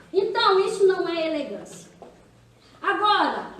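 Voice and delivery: normal talking voice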